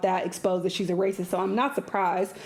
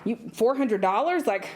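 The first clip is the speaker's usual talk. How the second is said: ordinary delivery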